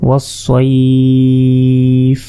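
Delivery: ওয়াসসাইফ held very long, closing on a vowelless f; elongated pause form. Shown as a demonstration. Teacher on silencing the last vowel, ওয়াসসাইফ stretched long. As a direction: draw out সাই, drop the final vowel after ফ. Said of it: "সাকিন হিসাবে উচ্চারণ করতে হবে".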